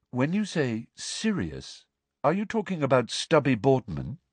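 unhurried, musing question; dreamy puzzlement. A narrator's reading. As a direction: ask it as an innocent aside.

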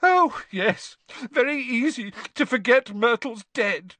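put-on high, wounded ghost-girl whine; self-pitying sarcasm through tears; gulping, tearful. A narrator's reading.

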